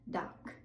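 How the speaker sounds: dock ending in a breathy K; unvoiced final K. Shown as a demonstration. Teacher contrasting dock with Dog. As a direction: release the K in dock as air only. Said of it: "then the unvoiced version".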